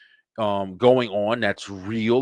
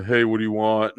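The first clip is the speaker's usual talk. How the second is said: put-on disgruntled teenager. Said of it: "the little disgruntled teenager".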